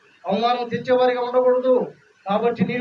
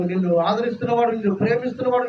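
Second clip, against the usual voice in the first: ordinary talk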